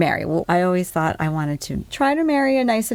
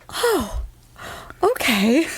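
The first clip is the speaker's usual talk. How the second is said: surprised; 7 semitones higher, much slower than usual. Retold or imitated. Her surprised reply quoted.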